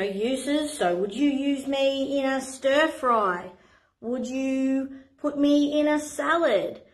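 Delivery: normal talking voice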